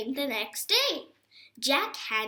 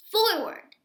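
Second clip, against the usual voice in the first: a raised, firm dog command; 9 semitones higher, 4 dB louder than usual. A narrator's reading. Morris commanding Buddy to move, firm call. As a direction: give the word like a trained command.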